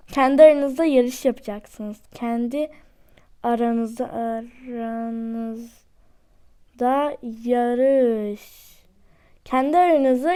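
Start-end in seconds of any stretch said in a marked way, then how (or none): 2.1-8.8 slow drawn-out repeat. Shown as a demonstration. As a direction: stretch "aranızda" out slowly, word by word. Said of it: "repeats slowly, drawn out".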